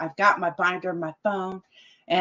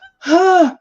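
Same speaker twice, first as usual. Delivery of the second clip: loud, high acted-out gasp; panicked gasp; 9 semitones higher, 11 dB louder than usual. Acts out her panic without binder.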